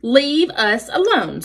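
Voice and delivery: drawn-out weasel protest; a slow, mildly squeaky plea; lightly voiced frightened little animal; squeaking weasel. A narrator's reading. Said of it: "squeaked Dart as he crouched low".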